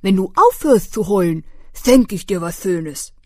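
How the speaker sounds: laute Maulwurfstimme; Maulwurf. Acted. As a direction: sag es laut und vorlaut als Maulwurf. (Maulwurf belehrt das Eichhörnchen, laut)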